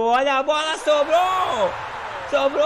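high, loud urgent yelling; excited shout. Real, not acted. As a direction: yell as the chance opens up. Ball loose in front of goal.

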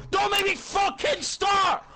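furious slow shout; raging gamer. Real, not acted. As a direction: scream it, slow and high. Raging threat at friend.

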